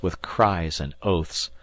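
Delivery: usual speaking voice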